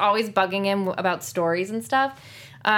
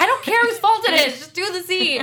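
higher, louder mock exasperated order; director snapping; 11 semitones higher, 6 dB louder than usual. Actress imitates director telling the giggling cast off, mock stern. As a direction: play the fed-up director. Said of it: "And he's like".

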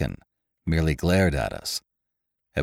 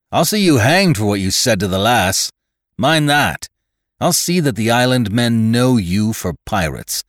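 loud, hard warning; angry threat; 5 semitones higher, 5 dB louder than usual. A narrator's reading. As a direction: threaten him fiercely. Narrator threatens Winter.

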